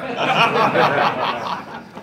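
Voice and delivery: loud, high laughing fit; burst of laughter. Real, not acted. Reader cracking up at own script, loud laughter. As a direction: crack up loudly.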